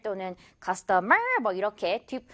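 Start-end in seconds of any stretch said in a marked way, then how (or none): 0.6-1.2 CUSTOMER as custo-MER, last syllable stretched; wrong final stress. Shown as a demonstration. Teacher showing a second mistake, "customer" stressed on MER. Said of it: "마지막 음절에 강세 주면 안".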